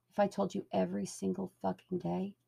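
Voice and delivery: ordinary delivery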